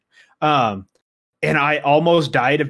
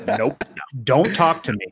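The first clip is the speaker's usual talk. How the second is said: ordinary talk, nothing marked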